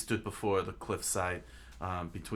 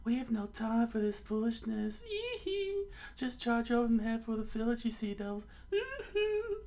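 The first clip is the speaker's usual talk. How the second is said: very high falsetto command with squealed whoops; high villain voice, shrieky hoots; hypnotist pirate captain. Acted. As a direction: order the charge in a squeaky cartoon voice.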